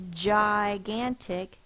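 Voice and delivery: gigantic said very slowly with a J at the start, jy-gan-tic; slow emphasised word. Shown as a demonstration. Teacher to pupils, slowly highlighting the J in gigantic. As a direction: stretch gigantic so the J sound is heard. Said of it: "if you hear our word".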